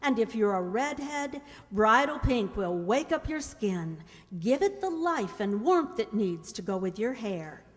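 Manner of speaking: usual speaking voice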